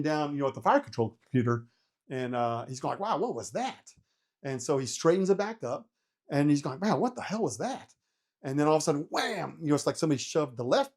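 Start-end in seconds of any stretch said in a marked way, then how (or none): 3.0-3.9 higher, softer mock surprise; retold wondering reaction, pitch lifted; puzzled surprise. Pilot as helicopter shoves right, puzzled surprise. 6.8-7.9 higher-pitched retold astonishment; startled puzzlement. Pilot straightening the helicopter, startled puzzlement.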